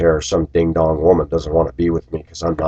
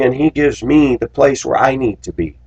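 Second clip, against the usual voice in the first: usual speaking voice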